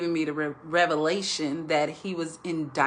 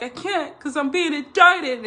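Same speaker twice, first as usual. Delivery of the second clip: frantic panicked shout, much higher and louder; hysterical Jim Carrey; 10 semitones higher, 6 dB louder than usual. Retold or imitated. Husband panicking in bed to his wife, frantic high shout. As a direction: imitate a man losing it over an indictment. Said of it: "he was just, like, so hysterical".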